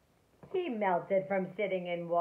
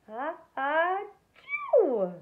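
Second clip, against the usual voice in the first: loud, high building sneeze; gigantic sneeze; 10 semitones higher, 10 dB louder, much slower than usual. A narrator's reading. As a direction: wind up and explode the sneeze. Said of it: "sneezed a gigantic".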